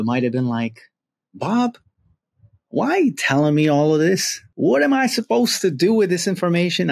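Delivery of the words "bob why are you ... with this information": higher-pitched grandfather in a put-on Southern accent; puzzled protest with a Southern accent. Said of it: "I'm putting on a, a Southern accent".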